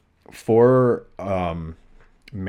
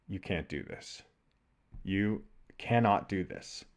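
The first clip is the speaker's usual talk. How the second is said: self-defeating voice; 7 dB quieter than usual. Retold or imitated. Another part of me telling him he can't, self-defeating. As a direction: quietly shut yourself down, pausing between statements. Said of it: "this self-defeating piece".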